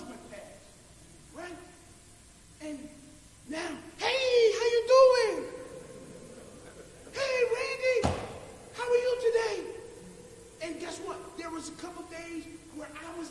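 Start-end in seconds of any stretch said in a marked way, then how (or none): 4.0-9.6 bright, cheery coworker hello; lightly voiced cheerful coworker; friendly greeting.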